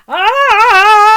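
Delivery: high, loud scream as he falls; falling giant scream. Acted. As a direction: yell out while tumbling.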